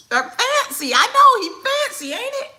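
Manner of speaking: very high, loud playful teasing; high teasing coo. Real, not acted. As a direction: gush over how fancy he looks. Teasing son's fanciness.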